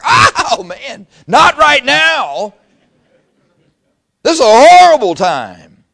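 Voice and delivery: loud, high, hammed-up panic; drawn-out mock-horrified shout; panicked protest. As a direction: wail it in comic dread. Panicked reply to God's summons.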